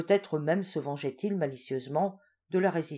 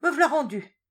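much higher, louder announcement; announcing arrival; 10 semitones higher, 9 dB louder than usual. Acted. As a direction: call it out cheerily. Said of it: "annonça Farin".

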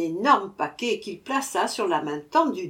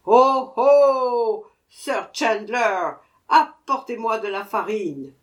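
dwarf shouting louder for flour; even louder cry; 2 semitones higher, 7 dB louder, slower than usual. A narrator's reading. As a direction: call out harder. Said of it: "cria encore plus fort qu'avant".

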